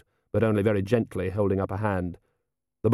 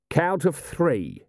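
slow, higher call without much volume; restrained shout, drawn out; deliberate ultimatum, pitched up; shouted warning; 7 semitones higher, much slower than usual. A narrator's reading. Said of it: "shouted Vimes".

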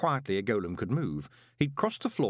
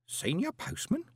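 much higher, disbelieving query; astonished question; 13 semitones higher than usual. A narrator's reading. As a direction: echo the title in wide-eyed surprise. Old man astonished by promotion.

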